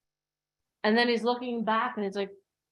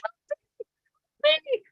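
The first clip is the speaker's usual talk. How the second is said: high-pitched panicked pleas; panicked mock screaming. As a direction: shriek it like someone drowning. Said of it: "are screaming".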